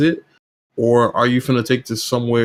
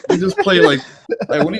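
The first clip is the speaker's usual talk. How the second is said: laughing retort; 6 semitones higher than usual. Retold or imitated. Laughing retort to fake fans.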